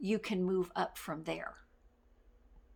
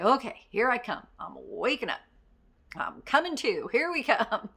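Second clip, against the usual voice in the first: bright, louder burst of energy; perky wake-up; 3 semitones higher, 7 dB louder than usual. Shown as a demonstration. Coaching listeners, she acts perking up loudly. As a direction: pump yourself up, louder.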